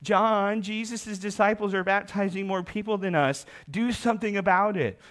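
high-pitched mock whine; whiny voice. Disciples complaining to John about Jesus' following, in a whiny voice. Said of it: "in this whiny voice".

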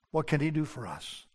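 usual speaking voice

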